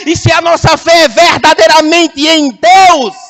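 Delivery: loud, high, full-force preaching; pregação inflamada. Real, not acted. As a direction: drive each phrase home to the congregation. Prega com toda força. (pregação exaltada, em voz alta)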